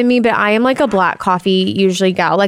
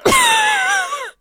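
coughing fit. Real, not acted. Chokes on a sweet sip.

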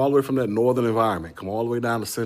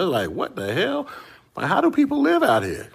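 mock disbelief; 10 semitones higher, 4 dB louder than usual. Shock at Kuwait midnight heat.

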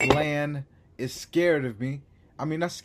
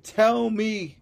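louder, pleading disbelief; incredulous; 5 semitones higher, 6 dB louder than usual. Real, not acted. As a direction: beg for a reality check.

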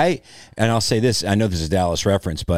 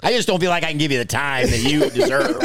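higher-pitched mock agent voice; agent letting go; 8 semitones higher than usual. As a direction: let the client down as a pretend agent. Acts the agent dropping the client.

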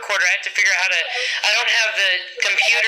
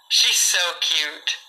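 usual speaking voice

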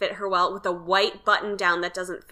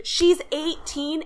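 mock scream of disbelief, pitched up; retold scream; 7 semitones higher, slower than usual. Host retells screaming at a model's age, high mock yell. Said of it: "then I screamed".

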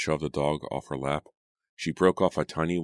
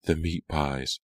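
ordinary delivery, nothing marked

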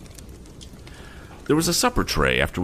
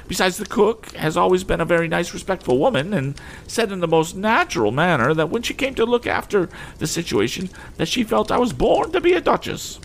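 much higher, outraged lady; indignant lady; 13 semitones higher than usual. Acted. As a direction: accuse him in a high huff. Mrs Pocket boasting.

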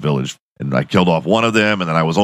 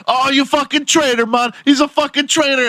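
put-on high, outraged Jamaican-accented yell; angry villagers. Shot villager yelling at Jason in a high mock Jamaican accent. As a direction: call him out as a traitor. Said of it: "They're like".

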